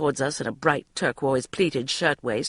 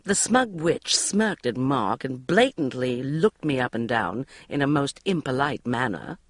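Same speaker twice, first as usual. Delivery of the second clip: ordinary delivery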